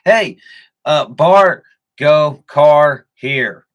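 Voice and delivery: loud, high, choppy one-word grunts; Florida man dialect. Retold or imitated. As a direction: bark single words like a local. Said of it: "Florida man has a dialect".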